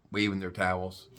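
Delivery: usual speaking voice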